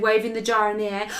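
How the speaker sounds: ordinary delivery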